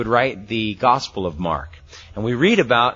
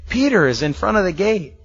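excited; 8 semitones higher than usual. Retold or imitated. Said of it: "She's so excited".

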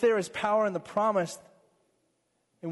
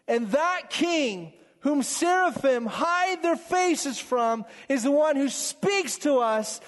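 swelling pulpit fervour; fervent preaching; 7 semitones higher, 4 dB louder than usual. Acted. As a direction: lift the congregation with rising passion. Builds to passionate preaching.

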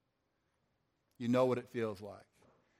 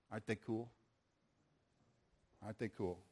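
tender aside; 5 semitones lower, 14 dB quieter than usual. Real, not acted. Proud aside to the Lord.

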